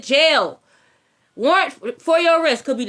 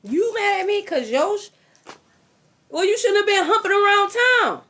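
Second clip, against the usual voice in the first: higher scolding taunt; mock scold, higher; 6 semitones higher than usual. To an unfaithful man, a higher mocking scold. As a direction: tease him higher for his cheating.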